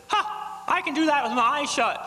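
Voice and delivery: a smug parrot showing off. Acted. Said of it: "He says".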